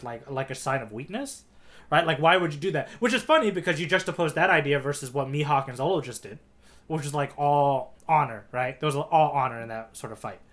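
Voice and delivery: ordinary talk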